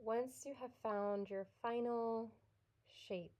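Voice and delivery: soft, unhurried yoga nidra guidance with long pauses; guided meditation. Real, not acted.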